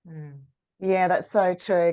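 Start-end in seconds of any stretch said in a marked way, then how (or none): none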